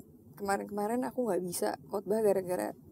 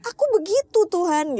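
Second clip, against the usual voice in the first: much higher, louder, tearful plea; tearful prayer; 8 semitones higher, 6 dB louder than usual. Retold or imitated. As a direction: cry it out to God. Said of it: "nangis, nangis, nangis". (doa sambil menangis, nada jauh lebih tinggi dan keras)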